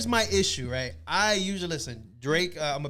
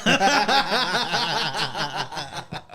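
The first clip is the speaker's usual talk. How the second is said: loud laugh; 4 semitones higher, 5 dB louder than usual. Real, not acted. Laughs at rocky joke.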